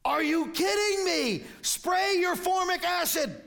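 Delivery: high mock yell of a worked-up child; imitated frustrated yell. Preacher imitating grandson yelling at a TV ant, high frustrated yell. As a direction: holler it like an exasperated little fan. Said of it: "Grayson yells out at the ant".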